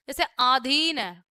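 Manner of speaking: normal talking voice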